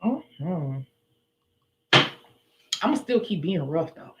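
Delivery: normal talking voice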